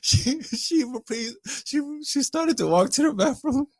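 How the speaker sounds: slow, high, laughing through words. Real, not acted. Retelling her walk to the mirror, laughing slowly.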